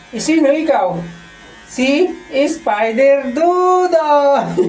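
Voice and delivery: put-on high, playful little kid voice; cute child voice. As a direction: squeak it like a toddler. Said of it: "Sabi mo kay Tito Bap Bap". (boses batang makulit)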